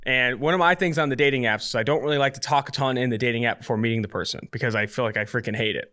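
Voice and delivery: ordinary talk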